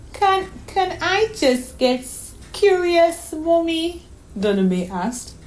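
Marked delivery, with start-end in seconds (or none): 0.1-4.1 put-on much higher, slow, halting child; little girl's voice. Daughter missing her mother on the phone, wistful. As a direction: stammer like a sad small girl.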